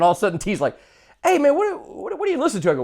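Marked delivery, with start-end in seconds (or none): 1.2-2.7 high, puzzled friend's question; mock bewildered. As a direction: play the confused friend, pitched up. Friend T's quote retold.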